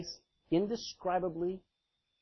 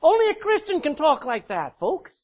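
much higher and louder, triumphant; exultant exclaim; 10 semitones higher, 8 dB louder than usual. Real, not acted. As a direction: proclaim it with joy. Preacher exclaims to congregation.

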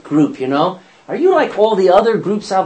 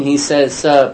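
ordinary talk

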